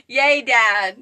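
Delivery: root for dad out loud; happy cheer. Real, not acted.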